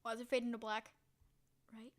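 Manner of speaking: usual speaking voice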